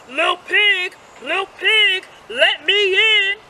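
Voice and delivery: wolf growling. A narrator's reading. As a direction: demand entry as the hungry wolf. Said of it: "growled the wolf".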